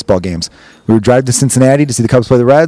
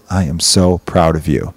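low, slow, softened sincerity; hushed, heartfelt acting; tender father; 7 semitones lower, 4 dB quieter, slower than usual. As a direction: say it slowly as a proud dad. Father's tender reunion with son.

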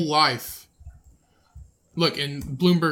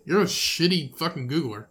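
normal talking voice

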